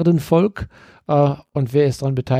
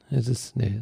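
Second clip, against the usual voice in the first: ordinary talk, nothing marked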